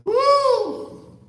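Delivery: loud high-pitched woo; high whoop. Real, not acted. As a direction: whoop out in disbelief. Laughing reaction to the caller.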